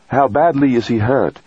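high-pitched character voice for the doctor; physician on comms. A narrator's reading. Base physician takes the astronaut's call, high-pitched professional voice. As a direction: answer the radio in a high voice.

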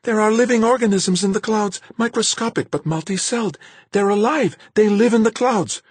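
high, loud, breathless rush of discovery; excited babbling. Acted. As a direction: tumble the words out near hysteria. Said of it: "She was babbling so hard".

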